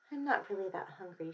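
soft, downcast reply; subdued. A narrator's reading. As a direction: say it quietly to mother. Goldie feels guilty at breakfast.

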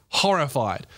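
ordinary talk, nothing marked

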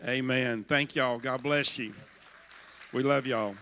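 normal talking voice

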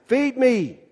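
high-pitched plant demand; hungry plant. Acted. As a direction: cry out like the hungry movie plant. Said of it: "the big plant that's like that".

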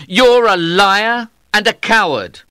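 high, indignant accusation; angry accusation. A narrator's reading. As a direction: throw the accusation at your rival, pitched high. Knight accuses his rival.

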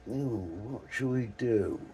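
ordinary delivery

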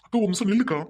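loud urgent plea to stop her; calling out. Acted. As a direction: call after her before she goes. Brother calls after leaving sister. (அவசரமாக அழைப்பு)

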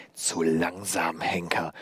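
slow, low whispery taunt; jeering. A narrator's reading. Old woman jeering at the too-slow executioner, mocking whisper. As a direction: draw out the sneer in a low whisper. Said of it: "höhnte sie und ihre Stimme".

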